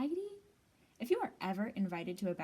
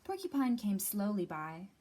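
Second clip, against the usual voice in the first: ordinary delivery, nothing marked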